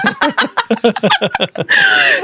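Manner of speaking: high, drawn-out laughing fit; long real laughter. Real, not acted. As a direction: dissolve into giggles. Laughs at end of retold session.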